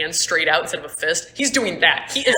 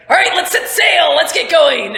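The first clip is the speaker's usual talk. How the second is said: high, loud rallying shout; captain's orders; 10 semitones higher, 9 dB louder than usual. Acted. Captain Joe orders crew to set sail, rousing shout. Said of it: "she's like".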